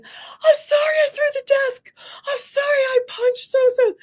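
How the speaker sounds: high-pitched imitation of a wailing boy; bawling kid. Boy confessing his sins, imitated bawling. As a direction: bawl out the apology like a child. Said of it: "he's just bawling and, and confessing".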